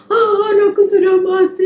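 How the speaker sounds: high, loud wailing cry; father wailing that his child is dead; grieving father crying out. Acted. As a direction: cry out in shock and grief. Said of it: "kakak imajinasikan seperti itu".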